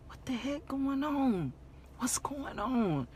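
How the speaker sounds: anxious half-whispered question; hushed worry. Retold or imitated. Worried, baffled self-talk retold.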